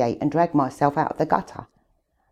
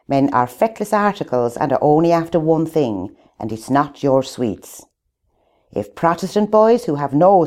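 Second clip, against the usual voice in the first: louder, laying down convent rules; strict nun lecturing; 6 dB louder than usual. Retold or imitated. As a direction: boom the rules at a hall of girls. Nun's sex education quoted.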